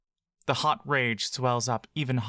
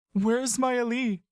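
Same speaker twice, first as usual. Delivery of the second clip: much higher, slow worried question; Buck's voice; 11 semitones higher, slower than usual. Acted. Buck asks Laurel where Maeli is, a slow high plea. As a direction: ask it with dread. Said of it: "you ask".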